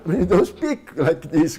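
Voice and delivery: loud, boisterous demo voice; too loud for church. Shown as a demonstration. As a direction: blurt it out as if unaware of the church. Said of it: "When you go in a church".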